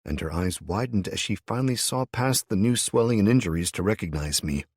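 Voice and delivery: plain reading voice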